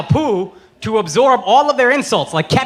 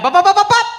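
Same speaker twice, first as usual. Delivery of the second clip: loud, high mimicked gunshots; mock gunshot noises; gun noises; 10 semitones higher, 5 dB louder than usual. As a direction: fire off imaginary shots, mouth-sound style. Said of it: "I could be like".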